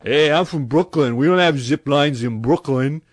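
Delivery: loud mock of a proud Brooklyn guy. Mocking Dan from the show, loud boastful Brooklyn voice. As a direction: puff up and brag about Brooklyn, mockingly. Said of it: "he was like".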